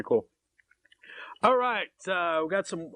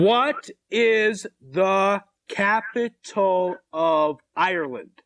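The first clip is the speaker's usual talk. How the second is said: drawn-out, word-by-word question; very slow repeat; much slower than usual. Real, not acted. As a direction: spell it out slowly for someone who can't follow. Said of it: "You're talking too fast again".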